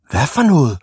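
high, startled cry; shouted disbelief. A narrator's reading. Big boys hear there are no brakes, shouting. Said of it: "råbte de store drenge i munden på hinanden".

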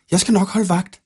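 put-on volunteer like a keen little boy; eager young wolf boy. A narrator's reading. Wolf boy offers the knight to keep watch, eagerly.

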